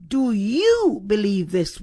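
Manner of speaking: "you" swells and stands out in the question; stress on YOU. Shown as a demonstration. Preacher asks how Jesus put the question, stress on YOU. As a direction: lean hard on "you". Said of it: "with the emphasis being on you".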